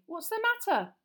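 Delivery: high, urgent questioning; alarmed question. Acted. Harry asking his terrified cousin, alarmed. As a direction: ask urgently, pitched high.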